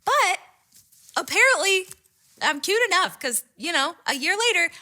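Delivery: high, cutesy sing-out; self-pleased squeaky voice; coy boast. Real, not acted. Coy boast about the outcome.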